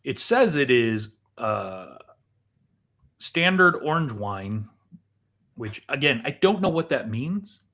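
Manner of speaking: ordinary delivery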